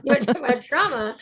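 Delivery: real laughing punchline; laughing emphasis. Real, not acted. Repeats it laughing, louder.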